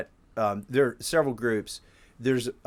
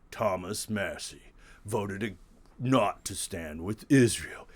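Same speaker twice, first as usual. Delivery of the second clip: slow attack-ad voiceover, ending loud; political attack ad; 7 dB louder, slower than usual. Retold or imitated. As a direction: read it like a campaign ad announcer. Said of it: "You should do political ad voiceover".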